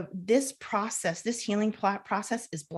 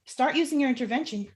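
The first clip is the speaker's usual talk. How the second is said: ordinary talk, nothing marked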